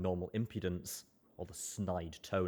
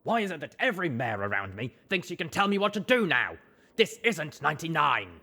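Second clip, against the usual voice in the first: angry outburst; 9 semitones higher, 11 dB louder than usual. Acted.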